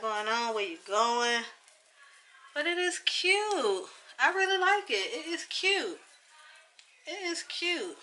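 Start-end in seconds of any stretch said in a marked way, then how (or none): 2.5-8.0 high, delighted repeats; gushing. Real, not acted. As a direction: coo over the wig. Gushing over the wig.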